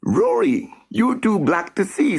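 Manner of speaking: put-on high, teasing boy voice; boyish joke. Sam teasing Rory down in the dark hole. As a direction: tease him lightly. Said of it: "said Sam".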